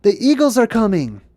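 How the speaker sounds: excited shout. Retold or imitated. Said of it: "he's like".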